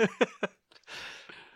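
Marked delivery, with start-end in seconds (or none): 0.0-0.1 high, loud, laughing mock dismissal; giggling, hammed-up self-centered host. Real, not acted. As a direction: cackle while brushing him off.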